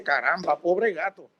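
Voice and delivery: soft, hushed "poor cat"; quiet pity. Retold or imitated. Pastor softly pitying the cat stuck in the tree.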